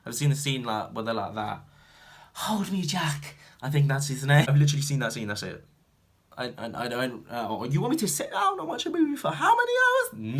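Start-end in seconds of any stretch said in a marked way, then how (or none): 2.3-3.4 high, slow breathless whisper; mock Titanic heroine. Imitating the Titanic scene, slow mock-dramatic whisper. 7.7-10.4 very high incredulous question; mock disbelief. Asked to sit through Titanic, high mock disbelief. Acted disbelief at long film.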